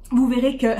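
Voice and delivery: ordinary talk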